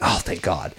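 low, hushed sigh of retold relief; relieved. Henry learns Maury is gonna be okay, relieved. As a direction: let it drop softly, like a weight lifting. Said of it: "like a weight was lifted off his shoulder".

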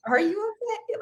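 very high, squeaky worried voice; concerned stranger. Retold or imitated. Strangers ask the sobbing runner, mocked very high and squeaky.

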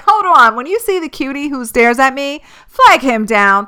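high, loud excited order to a friend; excited call. Retold or imitated. Telling her friend to flag down the cutie, excited and loud. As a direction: shout it out, thrilled at the chance. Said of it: "bitch, I was like".

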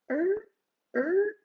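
very high, drawn-out creaky groan; eerie creak. Retold or imitated. Retelling the ghostly noise to a friend, high eerie creak. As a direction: imitate the spooky creak from the dark. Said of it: "And then I hear from the ping pong table".

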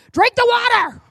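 loud, high mock yell at a child. Mother yelling at her child to drink water, loud high mock yell. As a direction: bark the order like a fed-up parent. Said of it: "Maybe that was just me yelling at my child".

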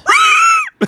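very high, piercing wail; banshee shriek. Acted. Imitating the wail heard on a country road, banshee shriek. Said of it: "you just hear this".

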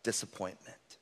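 soft, fading self-condemnation; quiet self-blame. Retold or imitated. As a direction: let it fade away into disappointment. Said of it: "you say".

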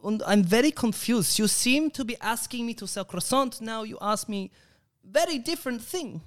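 high, confused mock German; German accent.

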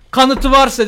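high, loud staged outrage; fake anger. Student to principal, theatrically angry. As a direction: play up the indignation. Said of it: "fake sinirleniş".

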